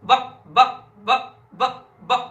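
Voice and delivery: ब said loud with the lips pressing and opening; demonstrating ba sound. Shown as a demonstration. Teacher sounding out ब for the class. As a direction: pop ब off the lips. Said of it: "दांत और होठ".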